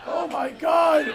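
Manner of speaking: high, slow shocked shout; shocked comedian. Retold or imitated. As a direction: gasp it out in horror, drawn out. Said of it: "And they just go".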